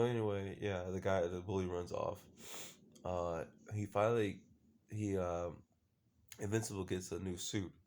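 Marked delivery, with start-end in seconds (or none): none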